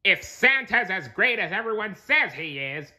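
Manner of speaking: high, sharp demand; demanding Squidward. A narrator's reading. Squidward demanding answers from SpongeBob. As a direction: press the question like a grump. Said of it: "Squidward demanded".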